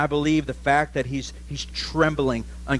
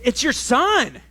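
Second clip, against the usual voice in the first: a higher, eager son asking his father; Esau's voice; 12 semitones higher than usual. Acted. Esau urging his blind father to eat, higher voice. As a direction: pitch it up as the hopeful elder son.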